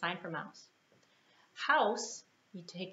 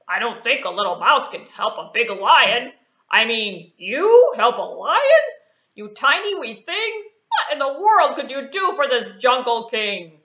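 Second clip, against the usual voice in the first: loud, mocking big lion; scoffing lion; 4 semitones higher, 15 dB louder than usual. Lion scoffing at the mouse's offer, loud and mocking. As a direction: laugh off the tiny mouse.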